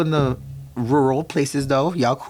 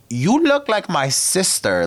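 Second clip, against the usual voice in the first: drawn-out, higher, sing-song sass; sassy retort; 8 semitones higher, much slower than usual. Retold or imitated. Teasing the immigration officer, slow sassy sing-song. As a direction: stretch it out like a playful jab.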